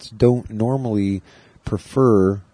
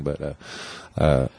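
ordinary talk

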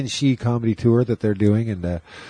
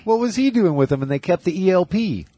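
amazed disbelief; 8 semitones higher than usual. Real, not acted. Host learns who replaced the band's drummer, astonished. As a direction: react in high, stunned disbelief. Learns surprising band line-up news.

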